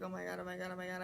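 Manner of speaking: low, soft, repetitive muttering; retold panic, kept quiet and low; muttered panic. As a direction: freak out under your breath. Retold private freak-out after stop.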